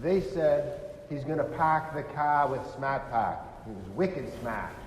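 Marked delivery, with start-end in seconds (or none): 1.1-3.4 put-on strong Boston accent, dropped R's; Bostonian accent. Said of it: "They had this strong Bostonian accent". 3.7-4.7 put-on strong Boston accent, dropped R's; Bostonian accent. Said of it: "They had this strong Bostonian accent".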